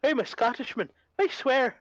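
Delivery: put-on high, protesting Irish-Scottish accent; mock Irish-Scottish accent. Teasing a friend's Irish-sounding Scottish accent, mock protest. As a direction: parody a botched Scottish accent, rising in pitch. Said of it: "Irish accent from the other night".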